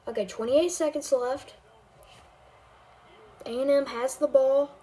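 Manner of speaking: game commentator calling the drive; amateur play-by-play over a video game; live play-by-play. Acted. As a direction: call each snap like a broadcaster.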